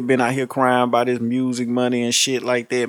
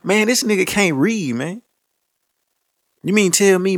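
usual speaking voice

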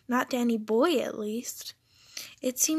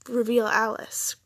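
ordinary delivery

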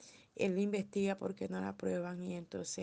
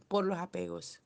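usual speaking voice

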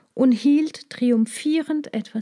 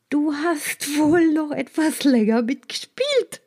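teasing snake voice; 5 semitones higher than usual. Acted. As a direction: needle the mole rat. Snake mocks the naked mole rat.

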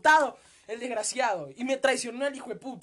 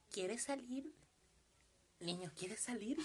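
creepy stranger voice; 14 dB quieter, slower than usual. Retold or imitated. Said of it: "la voz de pedófilo".

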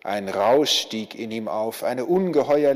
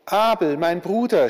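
higher, louder cry of a brother's name; anguished cry; 5 semitones higher, 5 dB louder than usual. A narrator's reading. War, finding his dead brother, cries out loud and high. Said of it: "schrie der Krieg".

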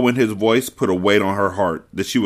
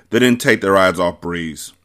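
usual speaking voice

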